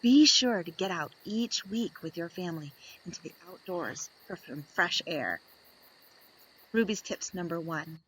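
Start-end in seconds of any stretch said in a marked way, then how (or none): none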